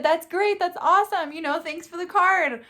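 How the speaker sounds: a hint of grandpa, pleasant and unfazed; calm grandpa. Grandpa calmly thanking for the card, lightly voiced. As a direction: say it high but easygoing. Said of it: "just calm".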